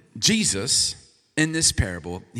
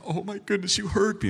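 lightly voiced delighted wife, higher pitch; thrilled wife; 6 semitones higher than usual. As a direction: imitate a pleased wife, pitched up. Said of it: "she was thrilled".

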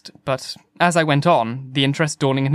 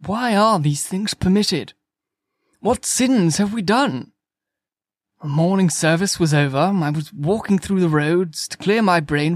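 higher-pitched, plaintive lament; complaining; 4 semitones higher than usual. A narrator's reading. Curate laments the destruction to a stranger, complaining. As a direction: bemoan it like a distraught clergyman. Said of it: "spoke in almost a complaining tone".

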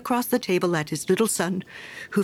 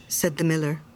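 plain reading voice

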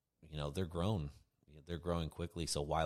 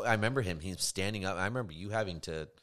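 usual speaking voice

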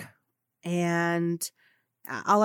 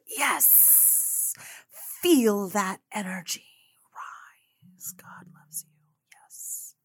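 whisper; 7 dB quieter, much slower than usual. Real, not acted. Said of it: "guided energy moment".

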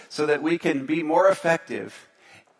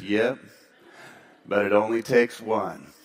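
lower, softer deadpan punchline; deadpan local; 7 semitones lower, 3 dB quieter than usual. Retold or imitated. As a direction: drop it dry like a wry old local.

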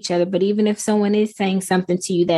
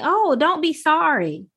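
higher, slow, soothing "don't be sorry"; imitated reassurance; 8 semitones higher than usual. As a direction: lightly play a listener comforting someone who is crying. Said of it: "the people listening saying".

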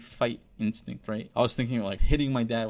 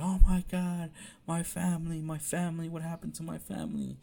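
retold breakdown; 5 semitones higher than usual. Son imitating his mom over her family, high fading breakdown. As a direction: mimic a mother falling apart, pitched up. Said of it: "My mom just has a fuck breakdown".

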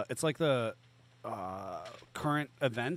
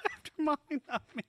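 high, laughing echo; 11 semitones higher than usual. Real, not acted. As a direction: repeat it through helpless laughter. Laughing as they repeat it.

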